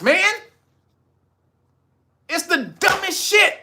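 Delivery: real exasperated outburst, high-pitched, words punched out; high, heated rant, pleading and fed up; pissed off. Real, not acted. Host berating viewers for not learning the law, pissed off. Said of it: "Sorry, I'm fucking pissed".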